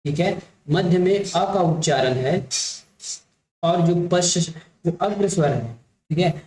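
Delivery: ordinary talk, nothing marked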